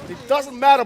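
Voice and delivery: excited esports caster call; play-by-play hype. Real, not acted. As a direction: shout the fight call live. Live play-by-play.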